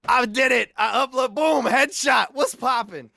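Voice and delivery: high, shouted mock triumph; hammed-up bragging. Retelling his pride at a Warzone clip, loud hammed-up boasting. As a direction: crow loudly over your own clip.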